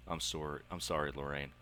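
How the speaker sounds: usual speaking voice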